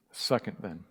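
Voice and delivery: ordinary talk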